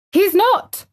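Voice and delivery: very high indignant cry; almost shout it in outrage; fierce indignation. A narrator's reading. Girl defends the boy up the wall, indignant. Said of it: "cried Mary, almost shouting up the wall in fierce indignation".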